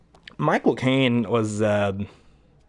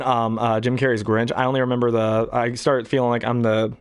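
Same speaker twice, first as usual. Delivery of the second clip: ordinary talk, nothing marked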